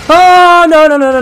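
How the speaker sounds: high, loud rapid panic; frantic repeated no; panicked shout. Real, not acted. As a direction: yell it as the fight goes wrong. Panicked in a fight.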